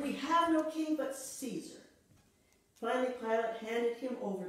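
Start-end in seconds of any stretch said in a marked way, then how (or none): 0.0-1.8 crowd's reply. Retold or imitated. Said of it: "And they said".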